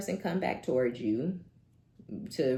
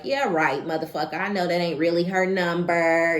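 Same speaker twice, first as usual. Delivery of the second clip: scoffing disbelief; 4 semitones higher, 10 dB louder than usual. Acted. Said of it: "and this person's like".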